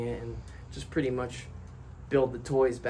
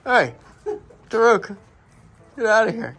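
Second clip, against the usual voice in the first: high, loud whoa at the bird; startled shout; 8 semitones higher, 12 dB louder than usual. Real, not acted. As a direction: yell the bird off. Parrot grabbing at him.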